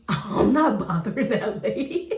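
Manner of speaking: nervous wife laughing off husband's idea; laughing refusal. Retold or imitated.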